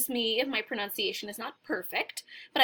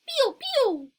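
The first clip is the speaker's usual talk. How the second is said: very high, peeping bird voice; chirping bird; 13 semitones higher than usual. Acted. Teacher shows learners how the bird says it, high chirp. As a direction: peep it like a tiny bird. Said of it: "I say it like that".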